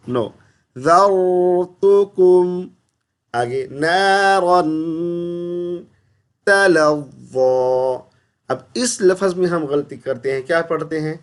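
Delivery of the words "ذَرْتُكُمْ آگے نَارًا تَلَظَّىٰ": slow melodic tajweed; correct recitation. Shown as a demonstration. Teacher models the correct reading. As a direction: recite it properly and slowly.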